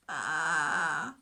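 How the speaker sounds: a weary, low little baa; tired bleat. Acted. Stuck lamb answers the shepherd with a tired bleat. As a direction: bleat faintly, worn out. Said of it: "a little bit louder bleat, but very tired".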